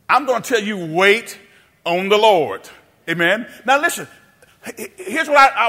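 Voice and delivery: normal talking voice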